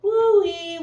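high loud siren wail; siren woo-wee. Acted. Police car siren coming through, high loud wail. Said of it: "went a siren".

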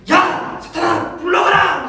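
높고 크게 버럭 지르는 호통; 화가 폭발한 듯 소리치기. Acted. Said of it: "화를 내고 있어요". (버럭 화내며 외치기)

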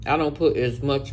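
chewing while talking; eating fries; mouth full. Real, not acted. Seen 1: Bit fry at -3; hand and fry at mouth until 1.1.

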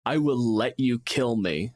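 ordinary talk, nothing marked

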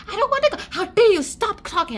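put-on loud, high-pitched childish protest; mock tantrum. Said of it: "they both have a tantrum battle".

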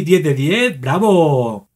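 normal talking voice

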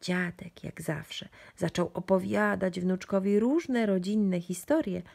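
plain reading voice

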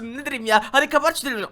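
ordinary delivery

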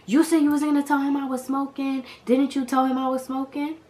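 high-pitched indignant accusation; retold exasperated challenge; vexed. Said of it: "I'm vexed".

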